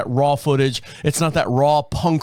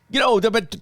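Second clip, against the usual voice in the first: higher-pitched, fumbled attempt at Scottish; bad Scottish accent; 7 semitones higher than usual. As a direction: try a Scottish accent and botch it. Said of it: "don't know how to do a Scottish accent".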